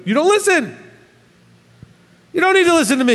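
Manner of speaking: high-pitched exasperated scolding; a preacher scolding his flock in mock exasperation. Real, not acted. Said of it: "Not mad at you".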